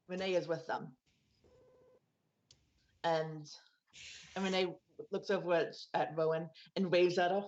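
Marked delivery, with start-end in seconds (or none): none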